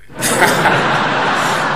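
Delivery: a short real laugh under his breath; breathy laugh. Real, not acted. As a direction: chuckle at your own punchline. Laughs at own joke.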